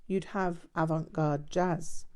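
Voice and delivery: plain reading voice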